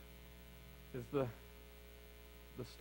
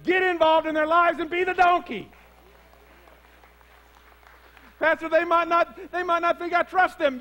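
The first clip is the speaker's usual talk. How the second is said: loud, high, driving preacher voice; full-force preaching; 10 semitones higher, 12 dB louder than usual. Acted. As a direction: preach it hard to the congregation.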